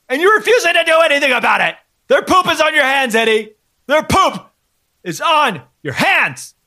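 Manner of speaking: high, fast, furious yelling. Acted. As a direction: scream at him down the phone. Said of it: "Can you just stop yelling?".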